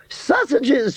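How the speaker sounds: very high delighted shriek; ecstatic scream. A narrator's reading. Aunt Sally thrilled at the breakfast news, very high delighted squeal. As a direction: squeal with joy at the word. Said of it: "she screamed ecstatically".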